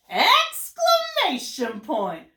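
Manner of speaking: big, high, drawn-out exclamation; thrilled, slow and punchy; happy and excited. Shown as a demonstration. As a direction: announce it loudly with stretched-out excitement. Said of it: "how I got really happy and excited".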